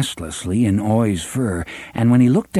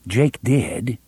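plain reading voice

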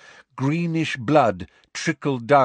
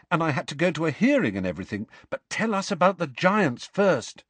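higher, quick schoolboy voice; earnest Harry; 8 semitones higher, faster than usual. A narrator's reading. Harry telling Hagrid of the attack, urgent. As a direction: pour out the news in a rush.

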